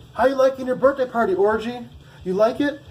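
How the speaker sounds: high, loud mocking voice; mock him with a big taunting voice; a drill instructor gloating over a recruit.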